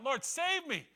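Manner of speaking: high, loud desperate plea; cry for help. Retold or imitated. Peter sinking in the lake, crying out to Jesus in panic. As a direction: call out as if going under. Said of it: "as Peter cries out".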